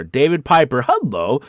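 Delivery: ordinary talk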